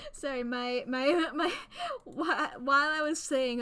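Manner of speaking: real laughter breaking up the words; laughing. Real, not acted. Streamer apologising for a camera mishap, laughing. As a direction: stumble loudly through it while laughing.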